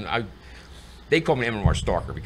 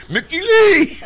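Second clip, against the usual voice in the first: laughing shout; 11 dB louder, much slower than usual. Retold or imitated. Shouting own name at the door.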